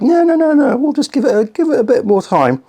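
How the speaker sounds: high-pitched voice of a reluctant heart; protesting heart. Pretend. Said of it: "but your heart's like".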